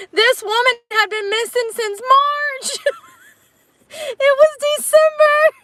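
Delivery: shrieking amazement; shocked disbelief. Real, not acted. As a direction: stretch out the shock. Shocked by the timeline.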